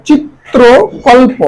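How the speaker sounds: চিত্রকল্প drawn out as "chit-tro-kol-po"; syllable-by-syllable pronunciation. Shown as a demonstration. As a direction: slowly split চিত্রকল্প. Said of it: "যেভাবে উচ্চারণ". (চিত্রকল্প টেনে ভেঙে উচ্চারণ)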